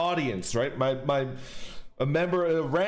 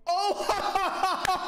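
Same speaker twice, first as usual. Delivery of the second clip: high giggly burst of laughter; nervous laugh; 12 semitones higher than usual. Real, not acted. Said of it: "Excuse my nervous laughter".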